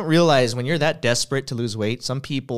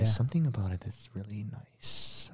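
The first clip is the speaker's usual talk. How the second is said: playful ASMR hush; 3 semitones lower, 7 dB quieter than usual. Pretend. Host showing friends how NPR talks, playful ASMR hush. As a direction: drop to a hushed NPR murmur. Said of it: "is this A S M R?".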